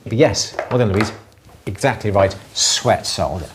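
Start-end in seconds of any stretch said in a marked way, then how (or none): none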